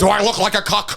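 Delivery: hammed-up offended villain; mock outrage. Acting rebuff by Doom.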